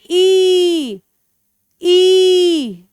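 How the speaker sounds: "ई" drawn out each time; long sound. Shown as a demonstration. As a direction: model a long, held "ई" for children. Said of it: "लंबा sound?". (हर "ई" लंबी खींचकर)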